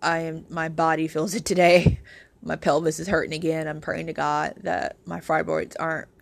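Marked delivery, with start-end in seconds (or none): none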